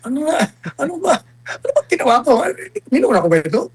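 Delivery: high, bewildered mock protest; incredulous disbelief. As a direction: play baffled, as if accused of nothing. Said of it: "sabi ko".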